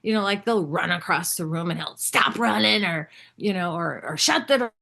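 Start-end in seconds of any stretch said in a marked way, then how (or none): 2.0-2.8 harsh dad. Retold or imitated. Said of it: "he's kinda harsh with them". 4.2-4.7 imitating a harsh dad, higher and louder; harsh dad command. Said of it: "he's kinda harsh with them".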